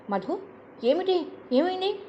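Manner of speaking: put-on worried older sister rushing to her; anxious. A narrator's reading. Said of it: "ఆదుర్దాగా మంచం మీద కూర్చుని". (ఆదుర్దాగా, ఎత్తైన స్వరంతో)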